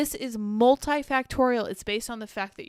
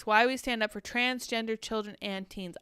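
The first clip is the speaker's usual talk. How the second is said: ordinary talk, nothing marked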